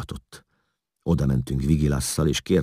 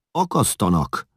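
higher, excusing exclamation; making excuses; 6 semitones higher than usual. A narrator's reading. Younger Hun excuses himself to Eszlasz, defensive and high. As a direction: blurt it out as an excuse. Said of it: "mentegetőzött a fiatalabbik hún".

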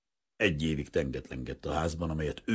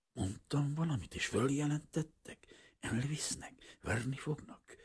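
hushed, softer, fearful questions; frightened whisper; 9 semitones higher, 3 dB quieter than usual. Acted. Old man dreading arrest, whispering fearfully. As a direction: barely breathe the fears out.

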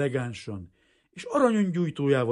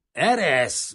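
loud, raised gruff order; rude growl; 5 semitones higher, 9 dB louder than usual. A narrator's reading. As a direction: snap rudely at the one holding you. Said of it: "mordult rá Gorombán". (gorombán rámordulva)

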